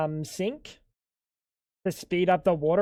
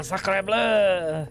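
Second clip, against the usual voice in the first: hammed-up French chef accent; 4 semitones lower, 4 dB quieter, slower than usual. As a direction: exclaim like a cartoon French cook. Mock French exclamation after laughing.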